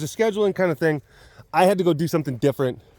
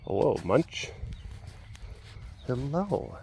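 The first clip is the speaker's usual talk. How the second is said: low, hushed hello to the dog; quiet tender greeting to a pet; soft pet greeting; 3 semitones lower, 11 dB quieter, slower than usual. Real, not acted. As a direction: coo gently at your pet.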